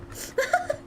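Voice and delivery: breathless high wheeze laugh; panting dog laugh. Real, not acted. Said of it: "not again".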